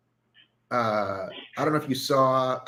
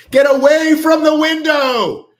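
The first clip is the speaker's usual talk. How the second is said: high, drawn-out panicked yell; hammed-up shriek of alarm; mock horror-movie scream; 13 semitones higher, 11 dB louder, slower than usual. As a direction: scream the warning like a slasher film. Mock horror-film warning, repeated shouted.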